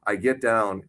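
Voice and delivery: normal talking voice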